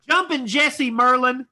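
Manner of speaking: big drawn-out curtain-call introduction; announcer roll call. Real, not acted. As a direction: stretch the name out for applause. Host's roll call of the cast.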